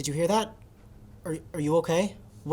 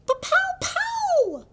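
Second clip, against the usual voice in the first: mock pow; much slower than usual. Excited sound effect gesture.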